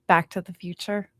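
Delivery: ordinary delivery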